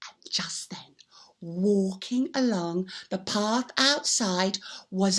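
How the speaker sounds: slow hushed whisper; suspenseful whisper. A narrator's reading. Storyteller whispering suspensefully to children. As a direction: draw them in with a secret.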